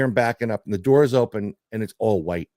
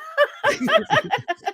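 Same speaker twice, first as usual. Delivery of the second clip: a big high laugh; high real laugh. Real, not acted. Laughing at his friend's joke about the card, high real laugh.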